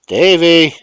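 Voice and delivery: loud, high call across the yard. Acted. Mother calling her son out, loud high call. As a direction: call the boy from the house. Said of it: "called Miss Allen".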